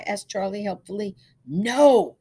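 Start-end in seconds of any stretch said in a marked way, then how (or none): 1.5-2.2 high, loud, drawn-out refusal; emphatic no. A narrator's reading. Man rejects the boy's store guess, emphatic.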